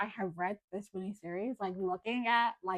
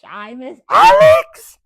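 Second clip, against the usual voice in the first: long, loud, slow wail of longing; hammed-up loud cry of missing him; mock wail; 5 semitones higher, 24 dB louder, much slower than usual. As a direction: wail the name like a heartbroken fan. Missing favourite character, wailing.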